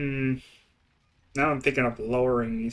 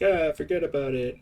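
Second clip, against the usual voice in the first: mock New York accent, a bit higher; 4 semitones higher than usual. Retelling a video guy in New York, mock New York accent. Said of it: "he was in New York, and he was just like".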